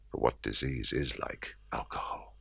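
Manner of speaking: hushed whisper, voice dropped right down. A narrator's reading. Narrator confiding to the listener about his drink, whispered. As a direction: breathe it out as a dark secret.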